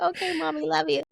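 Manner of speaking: put-on high, sing-song placating child; retold cutesy brush-off; placating sweet reply. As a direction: pitch it high, humoring mommy.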